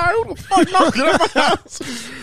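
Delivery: high-pitched mock outrage; pissed-off dad. Dad storming to the front door, imitated anger. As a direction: imitate a father fuming at the knocking. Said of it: "So my dad get pissed".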